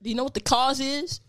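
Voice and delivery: loud and pushy; yelling. Real, not acted. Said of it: "Why are you yelling?".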